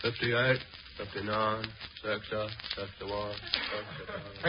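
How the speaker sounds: slow drowsy counting, fading; sleepy counting. Acted. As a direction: count off while nodding asleep. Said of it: "Probably counting sheep".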